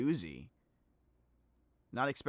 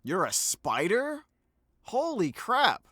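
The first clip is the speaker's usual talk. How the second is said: surprised; 9 semitones higher, 9 dB louder, much slower than usual. Real, not acted. Reacts to spider voice clip.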